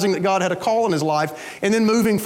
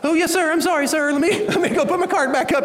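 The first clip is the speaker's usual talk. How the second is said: high-pitched, hurried apology, half laughing; mock a cowed man scrambling to comply; intimidated shoppers; 9 semitones higher than usual. Shoppers apologizing to the big man about carts, high meek voice. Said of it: "most of the guys are like".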